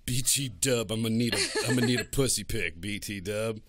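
laughing parody of a crude texter; mock horny guy. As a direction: ham up a sleazy texter. Imitating a guy's crude text.

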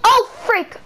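sudden high, loud yelp; startled yelp. Real, not acted. Gamer jumpscared by red-eyed rabbits, yelping. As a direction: jump at the fright.

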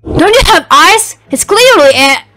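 loud, high-pitched snap; irritated outburst, breaking off; exasperated. Acted. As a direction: insist loudly as if it's obvious. Quarrel over bird's name.

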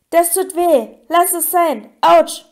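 protest, building to a loud yelp; pained protest. Acted. Harry is jabbed with the broomstick, pained protest. Prodded painfully in a dream.

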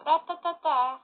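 mock machine gun rattle; gunfire imitation.

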